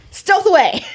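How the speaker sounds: high, loud mock-heroic call; gleeful exclamation. Player gleefully announces her sneaking.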